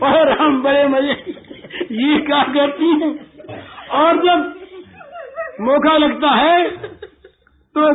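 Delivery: amused chuckling complaint; laughing gopi. Acted. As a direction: laugh as you tell it. Gopi laughing about mischief.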